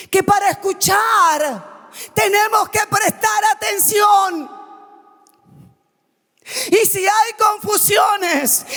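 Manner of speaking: full-force pulpit preaching; fiery preaching. Acted. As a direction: preach it to the whole church.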